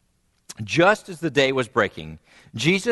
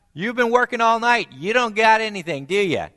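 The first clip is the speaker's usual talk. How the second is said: teasing stranger; 9 semitones higher than usual. Acted. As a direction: needle the tired fishermen. Voices stranger teasing fishermen.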